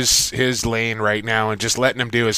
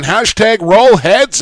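hyped; 8 semitones higher, 6 dB louder, slower than usual. Real, not acted. Hypes the team's winning streak.

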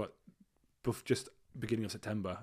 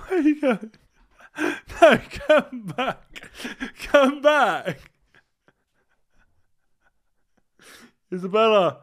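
calling out; 17 dB louder, slower than usual. Real, not acted.

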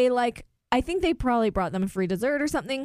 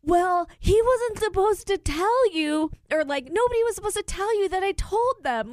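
retold quarrel; 9 semitones higher than usual. Diner arguing with partner over the server, imitated indignant protest. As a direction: mimic indignant self-defence, pitch way up. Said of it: "they got in a fight".

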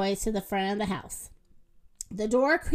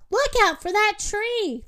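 high, urgent warning call; yelled warning; 8 semitones higher than usual. A narrator's reading. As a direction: warn them in a raised pitch. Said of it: "Moo yelled".

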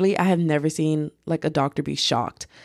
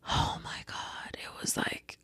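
exasperated whisper; 14 dB quieter than usual. Real, not acted. Telling viewers how annoyed she was, whispering. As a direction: whisper it, drained.

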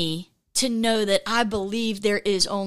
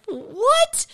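baffled wife. Retold or imitated. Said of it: "the wife kinda looks at him like".

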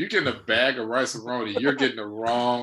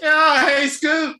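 much higher, loud cheer; excited cheer; 8 dB louder, much slower than usual. Real, not acted. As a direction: whoop for the fan. Cheering the fan.

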